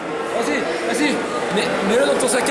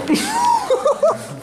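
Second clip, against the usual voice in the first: high-pitched laugh. Real, not acted. High laugh after stumbling.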